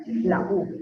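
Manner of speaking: ordinary delivery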